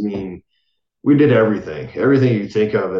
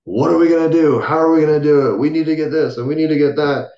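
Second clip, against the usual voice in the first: rapid-fire eager talk; 6 semitones higher than usual. Retold or imitated. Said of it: "she is going a mile a minute".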